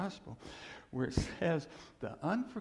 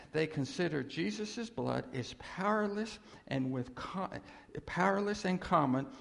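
ordinary talk

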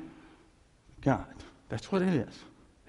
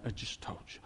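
whispered I just told you; God softly whispering the answer again; 5 semitones lower, 14 dB quieter than usual. Retold or imitated. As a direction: hush it like a patient reminder. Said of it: "He goes".

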